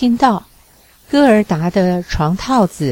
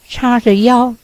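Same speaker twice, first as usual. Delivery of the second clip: usual speaking voice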